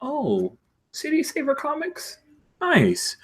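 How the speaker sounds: high-pitched flattered celebrity; pleased superhero. Acted. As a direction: coo it high and light, delighted. Shaker spots her own comics.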